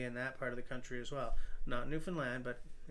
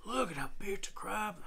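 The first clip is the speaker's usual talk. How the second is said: imitating Joe; 6 semitones higher than usual. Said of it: "If I was Joe, Joe's Neon, I'd be like".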